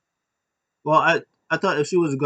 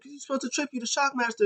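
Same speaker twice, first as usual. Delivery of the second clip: squeaky outrage; 12 semitones higher, 3 dB quieter, faster than usual. Retold or imitated. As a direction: squeal it in disbelief. Drake scolding Killian Dane.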